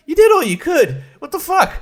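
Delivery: high, loud exasperated disbelief; indignant outburst. Retold or imitated. Reviewer defends the fired horse wrangler, high indignant. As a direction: shout your defence of the wrangler. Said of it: "And I'm like".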